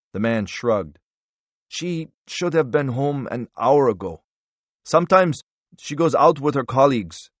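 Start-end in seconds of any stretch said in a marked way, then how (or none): 1.7-7.3 higher-pitched character voice; lightly voiced anxious husband; cornered husband. A narrator's reading. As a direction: explain it to the intruder, pitched up. Voices the wheelchair-bound husband.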